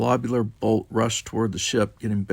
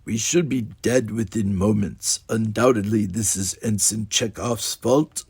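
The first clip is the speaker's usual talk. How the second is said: Spock impression. A narrator's reading. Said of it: "secured his posture in Spock form".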